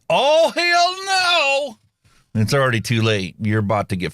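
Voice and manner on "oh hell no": very high, very slow Southern belle hell no; Southern woman's warning. Retold or imitated. Host voicing a Southern woman, high drawn-out hell no. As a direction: stretch it out high and sweet like a belle about to explode. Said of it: "When a Southern woman says".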